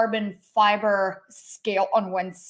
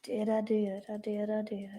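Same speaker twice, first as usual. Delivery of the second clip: whisper; 13 dB quieter than usual. Real, not acted. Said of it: "I said shit earlier".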